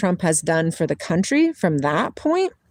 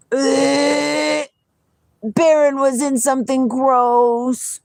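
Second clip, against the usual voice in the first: disgusted groan; 7 semitones higher, 4 dB louder, much slower than usual. Real, not acted. Dog smells awful.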